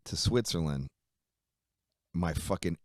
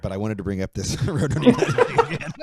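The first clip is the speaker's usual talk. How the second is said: louder, breaking into laughter; laughing retelling; 4 semitones higher, 10 dB louder, faster than usual. Retold or imitated. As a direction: crack up while quoting the request. Absurd request retold through laughter.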